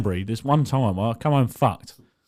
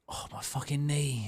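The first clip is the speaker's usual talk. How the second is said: muttered much softer, slow groan; a softer, slow hint of a hurt father; groaning mock dad; 13 dB quieter than usual. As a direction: play the dad moaning over his knee. Dad groaning after crash.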